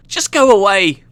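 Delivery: high, loud, exasperated wail; annoyed moaning cry. Acted. Half-conscious engineer sending the thief off, annoyed cry. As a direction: cry it out, woozy and fed up. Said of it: "half-conscious annoyance".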